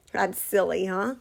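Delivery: usual speaking voice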